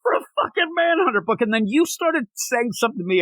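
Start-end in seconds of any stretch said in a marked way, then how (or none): 0.0-1.4 high, sarcastic rant, much higher all through; mock the cheer with bitter sarcasm; replaying his rant at the Manhunter news; sarcastic mock yell. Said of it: "I started yelling".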